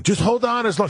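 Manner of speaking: a high, lightly voiced kicker; mocking the kicker. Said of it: "Matt Stover once again".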